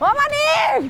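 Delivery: high-pitched wailing calls; tearful wailing. Acted. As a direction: cry out for help, stretching the name. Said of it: "বসে বসে কাঁদতেছেন".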